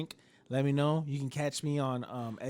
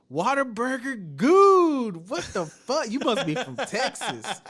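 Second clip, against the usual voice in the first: high, slow mocking gamer call-out; loud, high stranger's voice mocking the name; random gamer voice; 9 semitones higher, 8 dB louder, slower than usual. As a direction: mimic a stranger on the mic sneering at the gamertag. Random gamer calling out in lobby.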